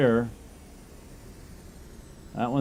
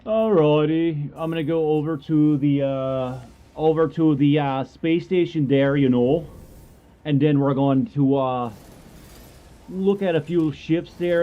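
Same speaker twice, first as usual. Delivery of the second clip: higher-pitched put-on Yooper accent; 4 semitones higher, 4 dB louder than usual. Streamer announcing space station plans and sign-off, in Yooper accent. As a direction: play up a folksy Yooper accent. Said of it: "I don't know why I'm doing the, the accent".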